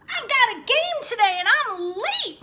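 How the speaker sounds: loud, high shout over the shoulder; a boy shouting back to his dad; yelling back. A narrator's reading. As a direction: yell it back while running. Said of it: "Michael yelled back".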